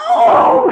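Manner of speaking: high, loud gasping cry; startled cry. Acted. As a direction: cry out in sudden alarm. Woman cries out when grabbed.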